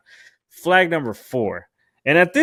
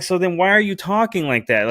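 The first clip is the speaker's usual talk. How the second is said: higher-pitched, retold exasperated question; mildly challenge, pitched up in disbelief; 8 semitones higher than usual. Retelling an argument with a date, exasperated challenge.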